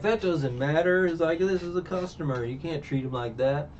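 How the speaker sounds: outraged cook. Retold or imitated. Patty defends the customer, outraged.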